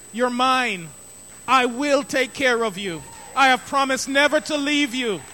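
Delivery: loud, fervent preaching as God's voice; God shouting. Acted. Preacher voicing God's shout of love to the congregation, loud. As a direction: call out God's promises with full force. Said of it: "That's what God is shouting at us today".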